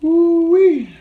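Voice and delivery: high loud woo-wee from the heat; spicy woo-wee. Real, not acted. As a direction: whoop at the pepper burn. Reacting to pepper heat.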